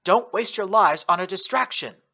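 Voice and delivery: loud, high commanding call. Acted. Narrator calling orders to the team under fire. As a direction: order them firmly across the room. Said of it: "I called out to the others".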